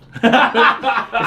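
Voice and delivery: loud laugh. Real, not acted. Laughing at his own boldness.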